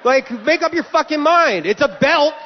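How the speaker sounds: high, indignant tirade; worked-up comic complaint at airport security; exasperated rant. Acted. Ranting at imagined screener.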